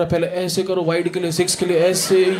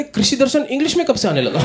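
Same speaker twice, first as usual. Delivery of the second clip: higher mock granny voice; grandmother's quip; 7 semitones higher than usual. Comic quoting his grandmother mocking the pitch report, higher granny voice. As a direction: lift up into a teasing old granny. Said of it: "मेरी नानी बैठी पीछे से बोले".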